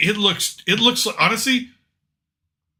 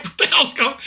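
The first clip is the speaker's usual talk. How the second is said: laughing disbelief; 9 semitones higher than usual. Real, not acted.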